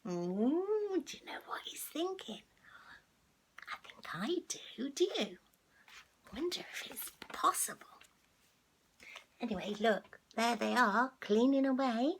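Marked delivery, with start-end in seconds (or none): 0.1-7.9 soft whisper sharing a secret; conspiratorial whisper. Real, not acted. Storyteller hinting the cat's plan, whispered.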